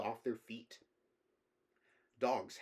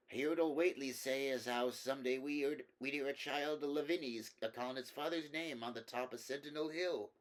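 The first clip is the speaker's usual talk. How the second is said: put-on rambling old man; 4 semitones higher than usual. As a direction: drift off like a wandering old villager. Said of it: "he rambled".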